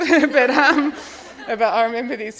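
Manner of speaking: laughing it out while admitting something strange; self-conscious laugh breaking through; embarrassed laugh. Real, not acted. Laughing while confiding her odd secret to the audience.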